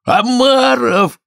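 very high, drawn-out yell; excited gryphon shriek; shout. A narrator's reading. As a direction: cry it out long and high. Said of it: "крикнул Грифон".

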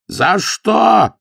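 громкий высокий грозный крик; вдруг закричи, угрожая. A narrator's reading. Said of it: "закричал вдруг на Иова истопник". (истопник кричит на Иова, замахиваясь камнем)

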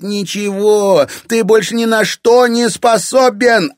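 high, loud, escalating shouting; hateful tirade. A narrator's reading. Irina defying her father and his household, hateful tirade. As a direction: build into screamed contempt for everyone. Daughter screams hatred at father.

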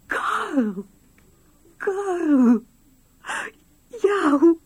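high, loud shout of his name, breaking into a cry; overjoyed cry. Acted. Wife greets husband back from the dead with a joyful cry. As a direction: call him out in stunned joy.

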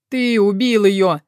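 slow, loud anguished wail. A narrator's reading. Roma accusing Artyom, a slow grieving wail. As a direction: howl it out in grief. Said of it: "завыл Рома".

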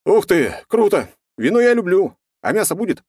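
high, loud delighted voice; excited. Acted. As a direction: burst in with childlike glee. Cheerful hero back alive.

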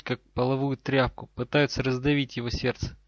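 ordinary delivery

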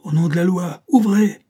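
loud, high official summons; police command. A narrator's reading. Policeman outside the door to the villain, shouted command. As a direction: order the door opened at full voice.

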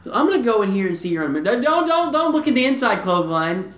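high-pitched, playful alarm; mock panic. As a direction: hurriedly warn someone off, hammed-up. Acts out snooper warned off the underwear.

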